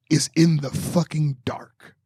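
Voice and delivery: ordinary talk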